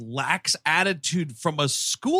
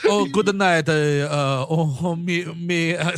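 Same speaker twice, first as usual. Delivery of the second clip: playful put-on Latin accent; hammy Latin lilt; 4 dB louder than usual. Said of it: "Is that a Latin accent?".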